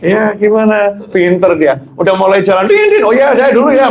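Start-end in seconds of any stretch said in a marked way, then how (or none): 2.7-3.0 very high beeping honk; spoken honks in a high voice; imitated car horn. Truck driver setting off, imitated high horn beeps. 3.1-3.9 high-pitched acted farewell; hurried goodbye call. Trucker leaving his video call, high acted goodbye.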